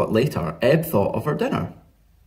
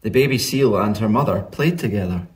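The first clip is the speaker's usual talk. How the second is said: ordinary delivery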